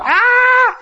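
sudden high shrieking "Ah!" of panic; panicked scream. Retold or imitated. Man who finds his flooded bedroom screams in panic.

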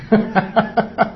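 short chuckle at his own mimicry; amused run of laughter. Real, not acted. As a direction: chuckle briefly after the joke.

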